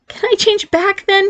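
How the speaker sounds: pleading. Acted. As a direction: beg to change back. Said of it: "he pleaded".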